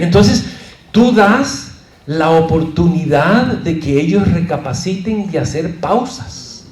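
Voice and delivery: ordinary delivery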